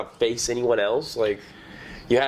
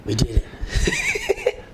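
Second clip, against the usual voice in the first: very high, slow victory cry; triumphant squeal; much slower than usual. Acted. Host cheering after getting the mic working. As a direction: stretch it out high and gleeful.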